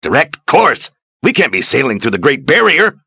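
high, loud horrified protest; alarmed outburst. Acted. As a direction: blurt it out in disbelief.

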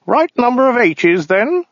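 high, prim alien voice; fussy clerk checking details; weaselly voice. Acted. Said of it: "said the alien".

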